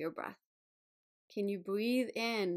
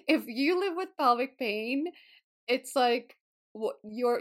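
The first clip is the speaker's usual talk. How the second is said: ordinary talk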